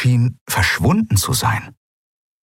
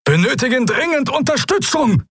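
high, urgent screech over a battle; shrieking for help, much higher; screeching captain. A narrator's reading. Captain screeching for reinforcements from the burning ring harbour, high urgent screech. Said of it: "kreischte die Projektion des Hauptmanns".

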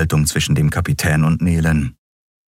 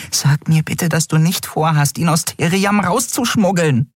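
high, pleading little voice; alarmed, high-pitched character voice; agitated Nelen; 12 semitones higher than usual. A narrator's reading. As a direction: implore in a raised pitch.

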